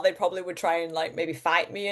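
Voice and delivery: normal talking voice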